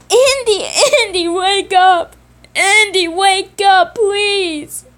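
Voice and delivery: high, loud sobbing calls; frantic crying. Acted. Simon trying to wake his knocked-out brother, crying loudly. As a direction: shake him awake, near tears. Simon finds Andy unconscious.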